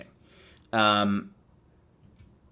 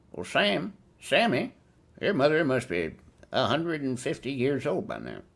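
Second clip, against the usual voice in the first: skeptical listener voice; 4 semitones higher than usual. Pretend. Imagined listener voice.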